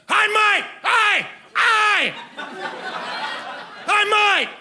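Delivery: hailing a stranger across the street; calling bloke. Retold or imitated. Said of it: "summoned my attention in the following manner".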